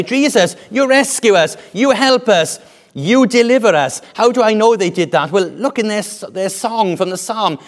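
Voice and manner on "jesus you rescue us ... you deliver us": high, loud, insistent cries; pleading crowd. Acted. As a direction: cry out to a saviour, phrase by phrase. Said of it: "and they say".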